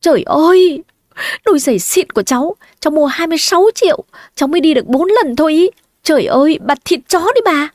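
high-pitched, hurried outraged yelling; wailing outcry. A narrator's reading. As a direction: cry out in acted dismay over ruined shoes. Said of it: "Tôi mới gào lên".

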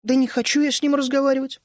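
put-on girl sulking at her friend; huffy snort. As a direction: huff it off. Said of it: "фыркнула Вишня".

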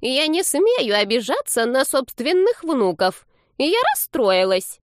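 put-on high, slow, drawn-out old-lady lament; wounded granny. A narrator's reading. Grandma to her grandchildren, high theatrically wounded voice. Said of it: "расслышала их родственница".

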